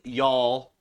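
Southern-accented "y'all", stretched slow. Shown as a demonstration. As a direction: lean into the Southern vowel on "y'all". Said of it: "you can't say that without an accent".

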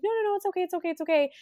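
high, quick no-no-no, brushing off the offer; mock-sweet bride declining food; polite refusal. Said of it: "She's like".

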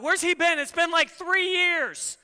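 mock-exasperated where's-he-been whine; impatient complaint. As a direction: complain that he's late. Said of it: "we're like".

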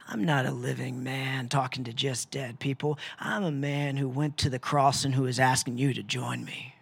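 low, soft, tender plea; Jesus tenderly asking them to follow. Acted. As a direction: gently invite them to the cross. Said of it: "Jesus looks at you tenderly".

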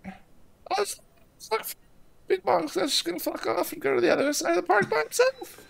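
high, soft cartoon voice, sulky; imaginary friend grumbling about the two-year-old; Bing Bong voice. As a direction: mope off like a rejected imaginary friend. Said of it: "But as Bing Bong, I have to say".

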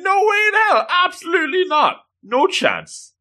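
very high mock outrage; hammed-up high-pitched rejection; outraged refusal. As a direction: squeal the refusal, easing down as it ends. Acted outraged refusal of a proposal.

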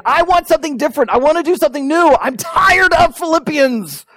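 high-pitched mock tantrum, dragging out the last words; frustrated. Pastor fed up with rereading Philippians, frustrated. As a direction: act out whiny impatience, pitched well up. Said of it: "Now I'm getting frustrated".

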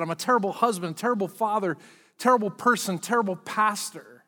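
ordinary talk, nothing marked

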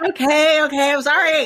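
high, loud laughing okay; giggling apology. Real, not acted. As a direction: laugh through the sorry.

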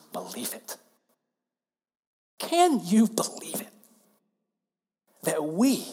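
hushed awe-struck whisper; a whispered marvel. Real, not acted. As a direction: breathe it out in wonder.

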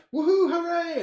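high, loud gleeful whoop; a hammed-up burst of joy; mock cheer. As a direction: whoop it up like a thrilled cartoon fish. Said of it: "And he's like".

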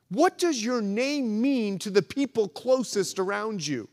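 ordinary talk

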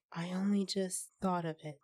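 plain reading voice

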